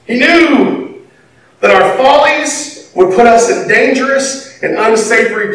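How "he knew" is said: ordinary delivery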